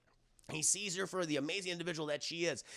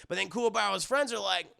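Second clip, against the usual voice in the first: ordinary delivery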